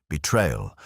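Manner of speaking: ordinary talk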